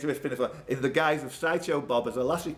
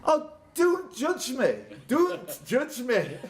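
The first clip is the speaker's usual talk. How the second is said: mock plea; 10 semitones higher, slower than usual. Host mock-pleading after his blunder.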